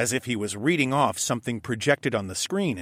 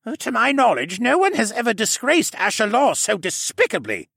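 spat; 12 semitones higher, 6 dB louder than usual. A narrator's reading. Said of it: "Jenner spat".

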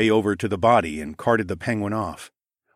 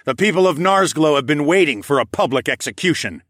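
loud, raised booming address; 7 semitones higher, 7 dB louder than usual. A narrator's reading. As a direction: boom it to the crowd. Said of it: "I boomed".

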